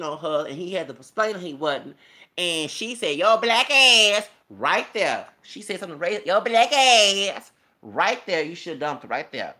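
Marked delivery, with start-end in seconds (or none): 3.2-4.3 loud, slow, drawn-out jeer; mock retold insult. Quoting her insult on the show, loud mocking drawl. 6.2-7.5 loud, slow, drawn-out jeer; mock retold insult. Quoting her insult on the show, loud mocking drawl.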